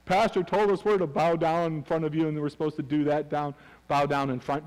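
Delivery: mock parroting in a droning monotone; imitated misguided listener. Congregant parroting the pastor's supposed orders to a spouse, mock droning. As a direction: drone it out like an obedient follower. Mimicking members misquoting the pastor.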